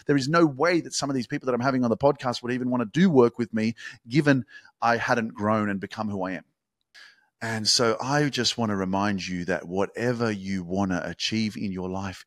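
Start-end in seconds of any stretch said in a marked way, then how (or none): none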